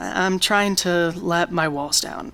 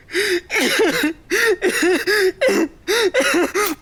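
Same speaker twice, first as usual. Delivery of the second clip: hysterical laugh; 11 semitones higher than usual. Acted. Breakdown laugh.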